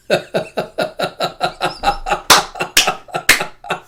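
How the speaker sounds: helpless fit of laughter; laughing hard. Real, not acted. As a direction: crack up and keep laughing. Reader cracks up at the story.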